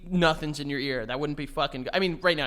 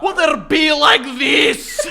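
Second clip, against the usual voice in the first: high, drawn-out Scottish bellow; screaming Scottish accent; 10 semitones higher, 11 dB louder, much slower than usual. Acted. Mocking Scots as always screaming, loud Scottish yell. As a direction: scream it slowly in exaggerated Scots. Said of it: "someone screaming at the top of their lungs".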